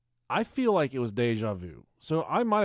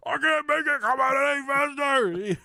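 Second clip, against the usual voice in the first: high, strained yell; high frantic toilet dad; 10 semitones higher than usual. Retold or imitated. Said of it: "continuing dad quote".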